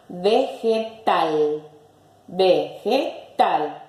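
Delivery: slow "Vegetal" in separate beats; split into syllables. Shown as a demonstration. Teacher presenting another example, syllable by syllable. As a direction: say "Vegetal" as ve, ge, tal with gaps.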